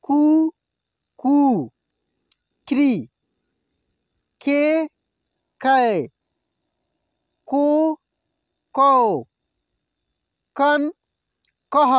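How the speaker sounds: each syllable called out slowly and loud, with pauses; slow drill recitation. Shown as a demonstration. Teacher reciting syllables for students to repeat aloud. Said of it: "अब मैं आपको practice कराऊंगा इसका".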